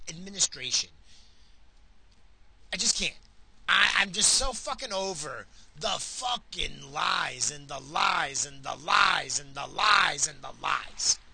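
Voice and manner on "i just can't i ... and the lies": slow, shouted, furious; fed-up rant. Real, not acted. Fed up with the administration, yelling at listeners. Ranting about administration lies.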